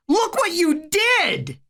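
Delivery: high, drawn-out furious cry; shrieky, angry blame; screamed. A narrator's reading. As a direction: scream the accusation up high, without full volume. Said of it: "Harry screamed".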